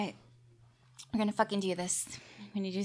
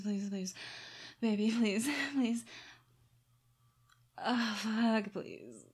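urgent, louder, rising pleas; breathless pleading; 2 semitones higher, 9 dB louder, slower than usual. Real, not acted. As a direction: beg breathlessly, building.

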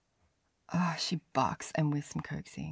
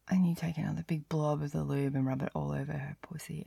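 ordinary delivery

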